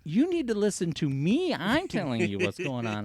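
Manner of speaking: high, loud heated insistence; heated argument. Retold or imitated. Gate boss arguing with the sheriff, loud and heated. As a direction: lay down the law.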